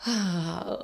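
long airy exhale; imitated sigh. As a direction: mimic his weary sigh. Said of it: "You just went".

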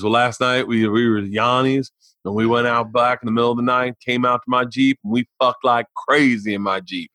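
ordinary delivery

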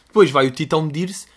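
normal talking voice

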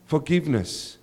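ordinary talk

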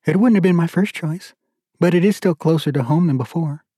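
a hint of a wife glad to go home; wife reassuring husband about the move south; hopeful wife. Wife voiced higher.

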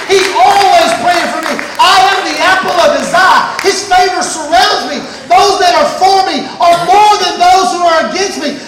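loud, driving sermon climax; preaching at full force. Acted. Preacher shouting his faith to the congregation, full force. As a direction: pound out each claim like a rising altar call.

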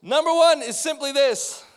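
loud, high preacher proclamation; booming point announcement. Real, not acted. Preacher announcing his first point loudly to the congregation.

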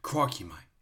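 lightly imitated Aussie croc hunter catchphrase; quick mock exclamation of an Australian wildlife host; Steve Irwin. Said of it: "he would just give us a lot of info about".

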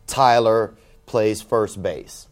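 drawn-out, very slow, word by word; slow signature read. Retold or imitated. Comic reading out the kid's text signature, very slow. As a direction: spell the signature out slowly for effect.

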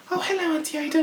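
very high sweet grandma voice. Retold or imitated. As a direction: pipe it up like a sweet old lady. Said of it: "Oh, your grandma voice".

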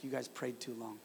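put-on soft, low, deadpan quote of a small boy; understated and quietly blunt; matter-of-fact child. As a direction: imitate a kid calmly lodging a complaint. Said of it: "He said it so matter of fact".

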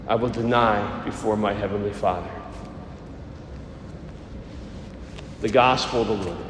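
solemn liturgical proclamation; Gospel reading. Acted. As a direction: proclaim it like scripture at Mass.

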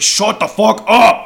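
high, loud, drawn-out yell; acted angry shout. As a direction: bellow to silence a screamer, hammed up. Turkey silences screaming girl.

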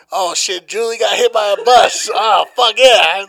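gleeful. Retold or imitated. Imagined glee at the bus.

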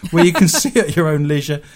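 laughing through the line; giggling while reading it out. Real, not acted. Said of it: "Who says men can't multitask".

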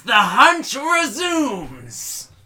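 high, slow gleeful shout; gleeful villain. Acted. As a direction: savor the cry of the hunt. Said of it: "with glee and says".

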